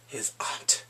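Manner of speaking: conspiratorial whisper; whispered secret. Real, not acted. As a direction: drop to an unvoiced whisper. Revealing a secret twist.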